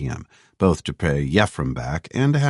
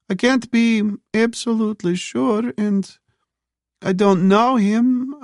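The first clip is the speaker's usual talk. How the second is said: put-on high, slow, hesitant boy's voice; nervous youth; 3 dB louder, slower than usual. A narrator's reading. Boy in the park answering Phoebe, high and hesitant. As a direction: voice him as a wary young teen.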